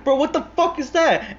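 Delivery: high, loud shocked yelp; shocked outburst. Retold or imitated. Hitman sees blood in the car: high shocked yelp.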